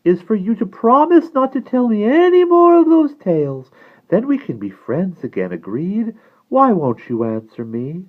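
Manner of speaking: high, loud, insistent plea; high-pitched entreaty, pressing for an answer; crying out as the Icarus. A narrator's reading. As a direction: plead loudly in a high voice. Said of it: "cried the Icarus".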